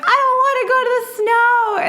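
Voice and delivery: high-pitched childish whine, acted out; petulant refusal. Upset daughter refusing dad's snow trip, high petulant whine. Retold crying sister refusing dad.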